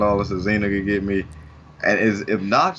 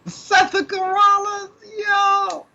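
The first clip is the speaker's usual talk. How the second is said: much higher, slow mock voice; mock squeal; 3 dB louder, much slower than usual. Cohost teasing the host's nickname, squealed high.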